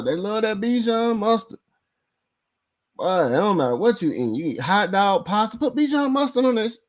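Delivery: high mocking voice, teasing the rival fans; mocking sing-song. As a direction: pitch it up and sneer at their taste. Keeps mocking rival fans' tastes.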